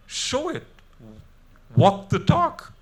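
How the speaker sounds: sudden loud, high challenge; exasperated outburst. Real, not acted. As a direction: throw the demand at the room. Speaker exhorts the audience.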